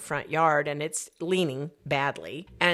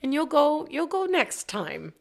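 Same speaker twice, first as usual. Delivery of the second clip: much higher, gently coaxing; soothing; 12 semitones higher than usual. Retold or imitated. Telling disappointed old James he'll go next time, coaxing. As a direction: coax him kindly, slowing at the end.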